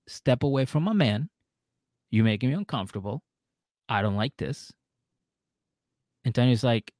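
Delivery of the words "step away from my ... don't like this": ordinary talk, nothing marked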